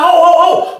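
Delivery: high, urgent holler; imitating a high alarmed yell; retold scream. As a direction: holler to stop someone leaving. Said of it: "I'm screaming through the side".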